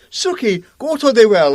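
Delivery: loud, high mock impression; Ricky Gervais. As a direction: call it out in a hammed-up comic voice. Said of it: "Fucking Ricky Gervais".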